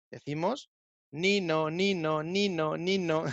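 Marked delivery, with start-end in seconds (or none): 1.1-3.2 sing-song two-note wailing "nino"; imitated siren. Shown as a demonstration. Teacher showing learners the siren sound, playful imitation. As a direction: imitate an ambulance with a swinging "nino". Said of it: "cómo nos referimos a este sonido".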